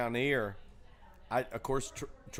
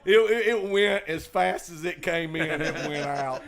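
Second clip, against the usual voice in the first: laughing; 5 semitones higher, 10 dB louder than usual. Real, not acted. Laughing while telling story.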